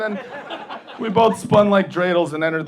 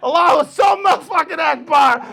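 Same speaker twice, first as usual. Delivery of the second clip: high, loud hype-man shout; Def Comedy Jam hype shout; 12 semitones higher, 4 dB louder than usual. Pretend. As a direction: yell it like a pumped comedy crowd. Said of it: "responded like it's Def Comedy Jam".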